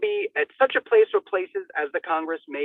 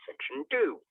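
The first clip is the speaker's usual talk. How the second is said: ordinary talk, nothing marked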